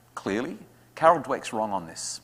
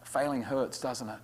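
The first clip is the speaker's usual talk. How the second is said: gentle empathy; 10 dB quieter, slower than usual. Shown as a demonstration. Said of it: "I'll say".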